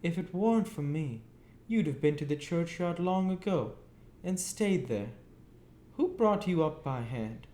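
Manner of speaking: put-on high, cross scolding of a boy; shrill-voiced sister scolding. A narrator's reading. Mrs Joe scolding Pip.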